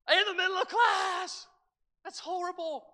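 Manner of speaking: high, loud mock shout of disgust; mock disgusted teacher. Teacher quoted in story.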